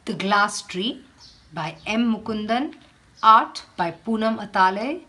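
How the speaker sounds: measured read-aloud for a class; teacher reading a picture book aloud; storybook reading. Acted. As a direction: tell the tale to children, unhurried.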